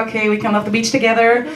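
ordinary talk

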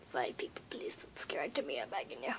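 low, hushed plea; soft pleading whisper; whispered begging. Pretend. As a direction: beg them under your breath.

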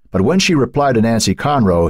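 plain reading voice

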